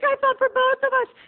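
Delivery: very high, squeaky acted reply; timid protest. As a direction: squeak out a meek defence. Timid boy defending himself.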